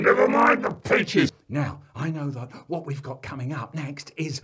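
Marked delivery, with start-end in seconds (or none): 0.0-1.3 loud, high-pitched snap back; exasperated shout. Acted. Dad to Joe as car rolls away, exasperated shout. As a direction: shout over the child's panic.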